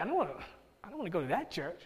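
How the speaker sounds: quietly balking, as a put-off newcomer; reluctant aside. Acted. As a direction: voice a reluctant listener under the breath. Acted reaction of reluctant listener.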